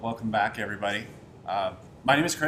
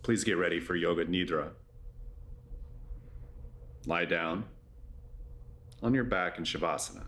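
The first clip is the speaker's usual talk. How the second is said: low, measured yoga nidra voice with long pauses; guided meditation; 8 semitones lower, 7 dB quieter than usual. Acted. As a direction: guide them gently into rest, low-pitched and unhurried.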